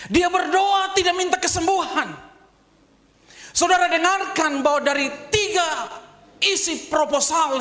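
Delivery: high, loud preaching at full force; fiery preaching. Acted. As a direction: preach it out to the congregation. (khotbah berapi-api, tinggi dan keras)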